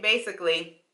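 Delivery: normal talking voice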